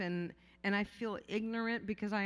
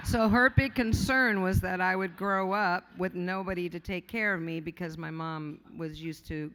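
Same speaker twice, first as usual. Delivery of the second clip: normal talking voice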